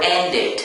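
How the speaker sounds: ENDED with final -ed as a full ID syllable; final-ed option. Shown as a demonstration. Teacher quizzing students on the ending, option with ID. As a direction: say ENDED as end-id. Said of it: "Is it pronounced".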